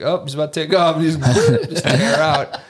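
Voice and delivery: laughing through the line; amused laughter breaking in. Real, not acted. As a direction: chuckle while telling it. Laughing retelling of outbursts.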